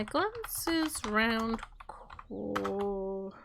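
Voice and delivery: very slow, soft and drawn out; slow absent muttering. Real, not acted. Player narrating own roleplay action, slow quiet drawl. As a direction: stretch the words out quietly, half to yourself.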